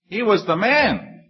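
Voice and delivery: high, slow and amazed; joyful retelling of the coincidence; delighted emphasis. Real, not acted. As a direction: savor the surprise. Delighted repeat of the punchline.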